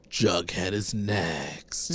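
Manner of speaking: low, slow, quiet threat; menacing stalker. Retold or imitated. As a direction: drag each word out like a mock villain. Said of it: "And he was like".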